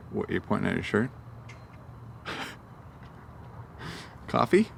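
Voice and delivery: ordinary delivery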